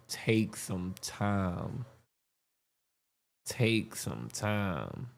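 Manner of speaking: very slow, soft insistence; slow emphasis. Real, not acted. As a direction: drag it out to make the point. Urging viewers to watch Delirious.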